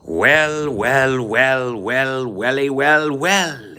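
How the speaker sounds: high, swooping mock British greeting; string it out in theatrical British swoops; Clockwork Orange accent. Asked for a Clockwork Orange accent, high theatrical British. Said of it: "Do a Clockwork Orange accent".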